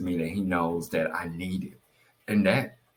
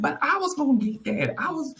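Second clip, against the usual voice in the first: very high-pitched mock protest; 3 dB louder than usual. Wife telling husband she meant to buy it, high mock protest. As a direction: squeal it like a playful complaint.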